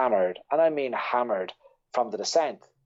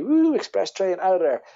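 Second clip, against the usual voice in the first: excited whoop; 7 semitones higher, 4 dB louder than usual. Retold or imitated. Mimicking the fast descent.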